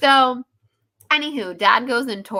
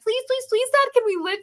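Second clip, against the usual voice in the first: high, pleading kid voices mocking the kids; kids begging; 14 semitones higher than usual. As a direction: whine it like little kids begging dad.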